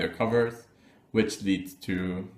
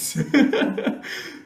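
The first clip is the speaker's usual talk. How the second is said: breathy laugh. Real, not acted. Laughs after a joke.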